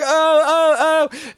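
string of high, loud mock moans; imitated moan. Wife reacting to Visitor Q in the film, loud mock moaning.

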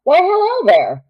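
high, lilting Southern greeting; flirty Southern frontierswoman; Southern drawl. Acted. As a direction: greet them in a North Carolina drawl. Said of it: "might channel a little bit North Carolina".

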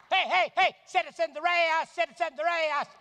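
loud, high repeated hollering; frantic calling out. Acted. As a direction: holler like a citizen making an arrest. [name] as Gomer calling out.

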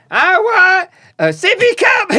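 put-on very high, slow whiny kid voice, yelled; mock kid yelling. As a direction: play a bratty toddler shrieking for a sippy cup. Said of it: "Just, like, yelling".

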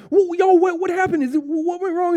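high, loud, alarmed questioning; frantic demand. Retold or imitated. Crew confronting the two guys about the leak, frantic loud demand. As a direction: demand answers in a rising panic.

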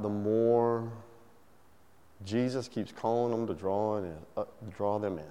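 soft, low, earnest sermon close; quiet preaching. Acted. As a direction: lean in and speak it softly.

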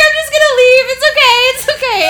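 high, loud, wobbly with laughter; high laughing it's okay; flustered reassurance. Retold or imitated. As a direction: tell everyone it's fine while falling apart. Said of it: "I'm like".

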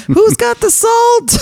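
very high, drawn-out comic call; mock calling out. Diehard in the snow asking for salt, mock calling out. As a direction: holler it in a squeaky high pitch.

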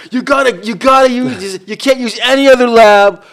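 high, loud urgent insisting; insistent friend. Retold or imitated. Friend Mitchell urging him to use the new lab, loud and pushy. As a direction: push it like a friend who won't take no. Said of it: "He goes".

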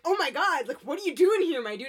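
high, loud mock shock; startled disbelief. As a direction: blurt it out like a startled rider. Startled rider as Voldemort appears.

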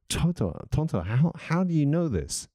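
high, baffled question to Tonto; puzzled Lone Ranger. Acted. Lone Ranger voiced in joke.

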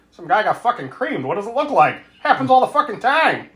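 high, grumbling Inspector Gadget voice; Stephen King impression. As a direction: complain like a cartoon inspector. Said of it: "sound like Inspector Gadget".

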